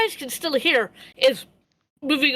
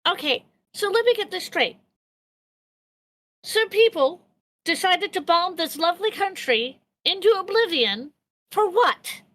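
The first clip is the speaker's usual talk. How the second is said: higher, incredulous character voice; 6 semitones higher than usual. Acted. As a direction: ask it high and disbelieving. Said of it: "Rex goes".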